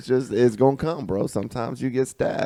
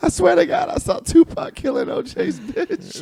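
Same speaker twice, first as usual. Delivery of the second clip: mock astonished witness. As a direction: squeal it like a hammed-up eyewitness. Acts an imagined witness.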